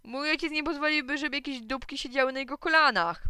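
high, indignant retort; a boy defending his father; shouting. A narrator's reading. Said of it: "krzyknął Joachim".

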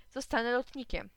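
ordinary delivery, nothing marked

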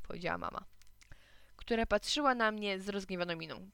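ordinary delivery, nothing marked

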